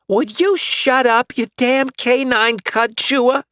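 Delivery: put-on high, loud, slow old lady scolding; cranky old woman. Old neighbor scolding the barking dog, high and cranky. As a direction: grumble it out like a fed-up old neighbor. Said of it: "came an all too familiar voice".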